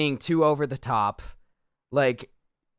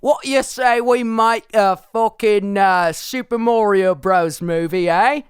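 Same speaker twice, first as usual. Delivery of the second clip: hammed-up Cockney, louder and higher; mock Cockney; 5 semitones higher, 4 dB louder than usual. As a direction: play a brash Cockney pitching a film. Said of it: "I'm just Cockney".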